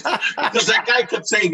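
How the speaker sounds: ordinary talk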